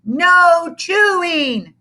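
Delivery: put-on slow, sing-song kids chanting; chiming children. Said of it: "they chimed".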